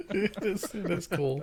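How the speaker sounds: high, laughing voice; laughing agreement. Real, not acted. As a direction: agree through real laughter. Laughing agreement with excited player.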